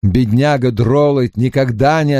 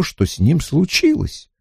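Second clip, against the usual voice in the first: ordinary delivery, nothing marked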